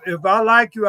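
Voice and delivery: ordinary delivery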